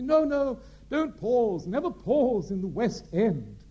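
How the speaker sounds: very high, loud, slow theatrical insistence; Gielgud insisting. Retold or imitated. Sir John overruling the actor, high, loud and slow. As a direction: hammer the rule home like a grand old director. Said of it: "Gielgud's reply".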